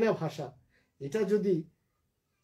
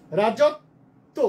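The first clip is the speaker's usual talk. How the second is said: রাজত্ব as "rajot", pause, "to"; loud, slow split before the final "to" of রাজত্ব; broken into syllables; 6 dB louder, much slower than usual. Shown as a demonstration. Teacher showing students how to spell, syllable by syllable. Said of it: "syllable ভেঙে ভেঙে অক্ষরটা আলাদা করে".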